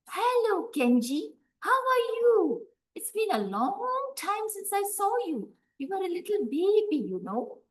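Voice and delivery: put-on high, loud sweet old lady greeting; a high, honeyed old woman luring the boy. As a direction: sing it up high like a too-friendly granny.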